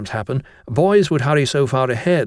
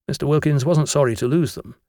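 ordinary delivery, nothing marked

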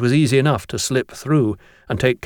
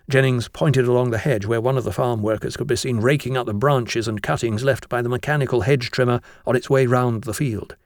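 ordinary delivery

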